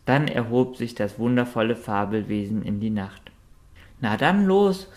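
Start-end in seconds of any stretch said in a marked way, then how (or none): 4.0-4.9 high, loud cheerful call. A narrator's reading. Sonja calls the group to get going, loud. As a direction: rouse everyone to set off. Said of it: "rief Sonja".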